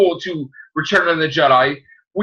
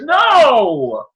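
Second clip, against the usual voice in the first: mock outraged rant; 6 semitones higher, slower than usual. Fan ranting about the Ewoks, high and indignant. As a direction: rant like a betrayed little fan.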